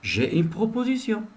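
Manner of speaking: student politely addressing the teacher. Shown as a demonstration. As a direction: offer the idea courteously. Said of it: "Ou bien".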